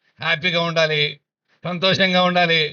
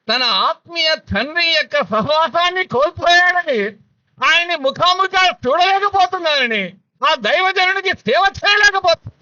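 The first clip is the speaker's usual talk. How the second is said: higher and climbing, pleading preacher; rising preaching fervour; 11 semitones higher, 4 dB louder than usual. Acted. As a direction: build the plea higher and higher. (పెరుగుతున్న ఉద్వేగంతో ప్రసంగం)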